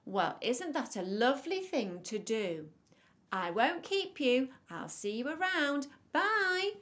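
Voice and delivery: loud, sing-out friendly farewell; storybook wolf playing nice, rising to a high "bye"; wolf character. A narrator's reading. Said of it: "said Mister Wolf".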